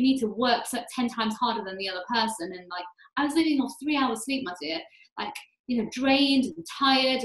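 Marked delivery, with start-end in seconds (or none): none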